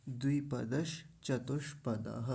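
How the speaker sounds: 'द्विपदश चतुष्पदः' slowly, echoed breath at the end; short pause between 'द्विपदश' and 'चतुष्पदः'; careful demonstration. Shown as a demonstration. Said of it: "ऐसा".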